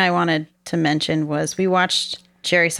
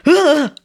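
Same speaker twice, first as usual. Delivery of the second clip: mock startled grunt; 10 semitones higher, 7 dB louder than usual. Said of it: "You're just like".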